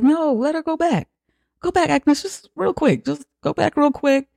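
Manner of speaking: high, whiny mock pleading; mocking Bjorn. Bjorn coaxing Agnes back to the floor, high mock whine. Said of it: "Bjorn's like".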